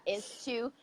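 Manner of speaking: ordinary talk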